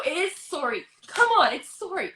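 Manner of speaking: "sorry" with a rounded O, "SOR-ee"; Canadian sorry. Shown as a demonstration. Canadian defending it, "sorry" insistently as "SOR-ee". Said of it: "the word sorry in scripts just to trip up us Canadians".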